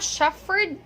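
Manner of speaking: "shepherd" said with F, as SHEF-ferd; wrong F pronunciation. Shown as a demonstration. Teacher warning learners off the error, F mispronunciation. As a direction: turn the PH of "shepherd" into F. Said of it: "it's not shepherd".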